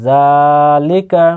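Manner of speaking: slow জালিকা with a long, held জা; drawn-out vowel. Shown as a demonstration. Teacher demonstrating one alif length, জা drawn out. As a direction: stretch the জা of জালিকা, then short লিকা. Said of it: "জালকে এক আলিফ পরিমাণ টানবেন".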